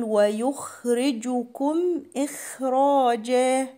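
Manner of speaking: "ويخرجكم إخراجا" with a full, unvowelled خ; heavy kha. Shown as a demonstration. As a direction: linger on a thick خ in "ويخرجكم" and "إخراجا". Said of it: "حرف الخاء من الحروف المفخمة".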